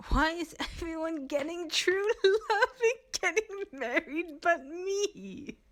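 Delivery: hammed-up tearful whine; mock wailing. Theodosia bursts into mock tears at the party. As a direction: wail it half-laughing. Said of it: "Theodosia breaks into tears".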